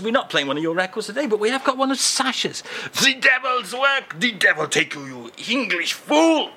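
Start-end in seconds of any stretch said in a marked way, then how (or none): 3.0-6.5 a hammed-up furious Frenchman; angry outburst. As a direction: curse the English fool in outrage. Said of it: "The heat's not calmed him down any".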